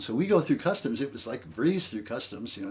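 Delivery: usual speaking voice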